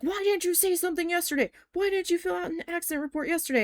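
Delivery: high-pitched retold scolding; pissed-off management. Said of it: "management was pissed".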